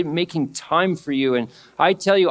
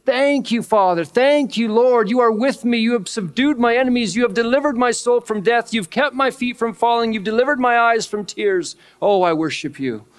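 much higher, fervent voice of thanksgiving; thankful praise; 7 semitones higher than usual. Acted. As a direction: pour out thanks like David overflowing. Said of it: "And he's just".

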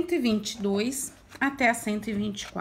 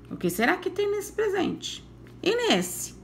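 high, soft, wondering question; curious teacher voice; 8 semitones higher, 5 dB quieter than usual. Acted. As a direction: tease the children with a mystery. Teacher builds curiosity for children.

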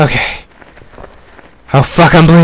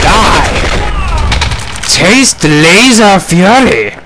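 hammy battle cry; 6 semitones higher, much slower than usual. Acted.

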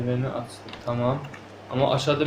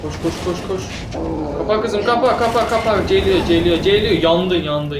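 panicked shouting while fleeing; being chased; 7 semitones higher, 8 dB louder than usual. Real, not acted. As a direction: yell in a frantic rush. Zombies chasing, door closing. (kovalanırken panikle bağırarak)

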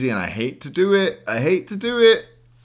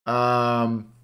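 ordinary delivery

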